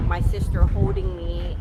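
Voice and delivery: ordinary delivery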